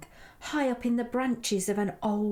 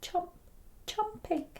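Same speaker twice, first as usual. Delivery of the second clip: hushed, higher-pitched little chomps; very soft; 8 semitones higher, 5 dB quieter than usual. A narrator's reading. Edward hears a tiny creature munching, very softly. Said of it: "a very soft chomp".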